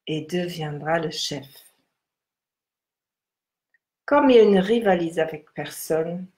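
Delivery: measured, solemn text reading. Acted. Reads the Tao text aloud.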